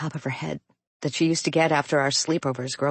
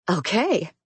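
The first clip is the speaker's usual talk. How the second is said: decisive; 5 semitones higher than usual. A narrator's reading. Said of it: "Clara answers definitively".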